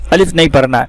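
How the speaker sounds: ordinary talk, nothing marked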